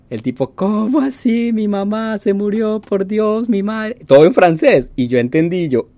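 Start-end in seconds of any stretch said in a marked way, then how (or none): 0.5-4.0 high tearful wail of sudden grief; imitating crying driver. Uber driver hearing his mother died, imitated high tearful wail. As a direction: mimic a man breaking down, voice pitched up high. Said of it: "Y el tipo obviamente llorando".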